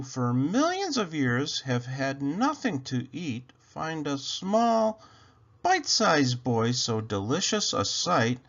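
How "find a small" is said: ordinary delivery, nothing marked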